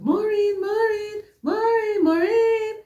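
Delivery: high, drawn-out call to wake a patient; calling her name. Retold or imitated. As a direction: call out her name as if she's far away.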